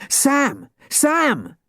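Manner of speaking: very high urgent calling; high alarmed yell; panicked shout. A narrator's reading. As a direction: call out in panic. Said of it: "he shouted, his face flushed with panic".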